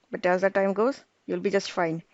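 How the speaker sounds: ordinary talk, nothing marked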